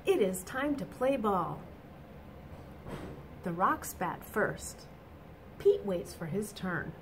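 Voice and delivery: bright, lively storybook reading; reading to children. A narrator's reading. Teacher reading a picture book to children, animated. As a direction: read it out to the little ones with energy.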